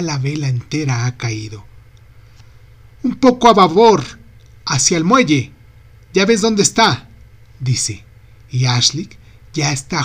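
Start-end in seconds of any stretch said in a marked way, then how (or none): 3.0-7.1 calling out. A narrator's reading. Ashley calling steering orders.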